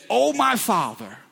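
high, pleading cry to a father; reading a son's bitter wail. A narrator's reading. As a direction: cry it out in anguish. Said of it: "a loud and bitter cry".

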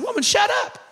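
high, loud retort to wife; hammed-up indignant shout; mock exasperated yell. Acts own retort to laughing wife.

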